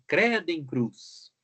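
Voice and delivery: slow, emphatic "Credo em cruz" exclaimed; exclamation. Shown as a demonstration. Teacher demonstrates an exclamation to the class, slow and emphatic. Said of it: "exclamar a intensidade de uma emoção".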